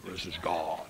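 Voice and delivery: very low, soft, booming villain voice; Darth Vader voice. Retold or imitated. Preacher imitating James Earl Jones as Vader: deep and low. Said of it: "he's got that Darth Vader".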